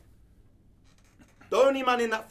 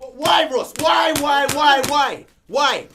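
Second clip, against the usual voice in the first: shouting why again and again, much louder and higher; furious shout; 5 semitones higher, 10 dB louder, much slower than usual. Real, not acted. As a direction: demand an answer at full volume. Shouting at Ross over football.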